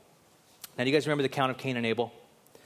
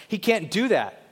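ordinary talk, nothing marked

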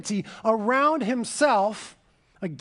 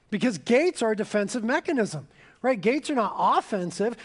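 ordinary talk

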